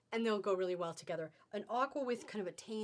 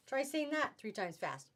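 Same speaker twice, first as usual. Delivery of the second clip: ordinary delivery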